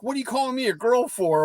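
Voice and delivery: put-on quick, high-pitched indignant kid; mock offense. Boy on the team objects to being called girl, mock indignation. Offended boy's reaction, acted.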